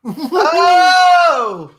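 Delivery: high, loud burst of excitement; excited shout. Real, not acted. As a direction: shout it out in delight. Reacting to the putt.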